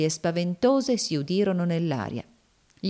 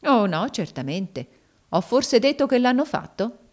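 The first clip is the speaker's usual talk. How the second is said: mock-innocent sneer, pitched up on the question; icy derision; 6 semitones higher, 3 dB louder than usual. A narrator's reading. Slave woman mocking her frightened master, icy derision. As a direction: feign agreement, coolly mocking him. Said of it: "con un sogghigno di gelida derisione".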